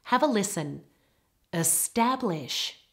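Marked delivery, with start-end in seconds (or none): 1.5-2.8 "Establish" slowly, stress all on the middle part; second-syllable stress. Shown as a demonstration. Teacher asks learners to have a listen, second-syllable stress on "Establish". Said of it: "stress is all on the second part".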